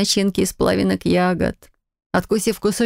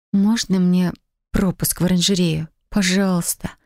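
usual speaking voice

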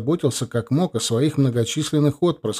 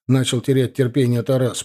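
ordinary delivery, nothing marked

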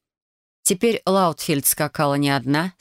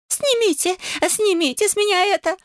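much higher, frantic squeal; shrieking. A narrator's reading. Neighbour begging them to get the sap off her, shrieking. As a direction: shriek it in panic. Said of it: "визжала соседка".

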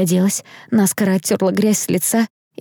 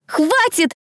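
high, strained plea to stop; anguished tearful cry; 10 semitones higher than usual. A narrator's reading. Girl begging crowd to stop, anguished cry. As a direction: cry out through tears. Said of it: "надрывно закричала Дэрил сквозь слёзы".

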